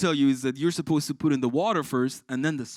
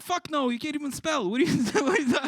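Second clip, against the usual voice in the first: laughing retort; 13 semitones higher, 4 dB louder than usual. Retold or imitated. Teacher retorts to child.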